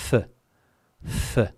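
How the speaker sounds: th alone, as at the start of think; isolated th sound. Shown as a demonstration. Teacher explains the Castilian C, th sounded alone. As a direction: sound out th, pausing between each. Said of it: "is pronounced like we pronounce in English".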